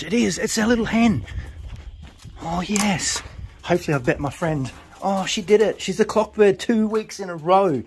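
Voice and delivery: rising joy as the first hen lands; thrilled, bursting with pride; excited. Real, not acted. Pigeon fancier cheering his hen home, real excitement.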